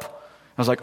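normal talking voice

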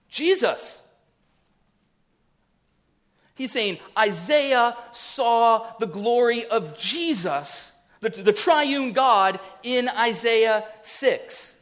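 high-pitched, emphatic pulpit proclamation; fervent preaching. Real, not acted. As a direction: preach it high, leaning on the key words.

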